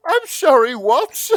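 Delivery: high, loud incredulous cry; can't believe what you just heard; real outraged disbelief; stunned disbelief. Real, not acted. Disbelief at the summary.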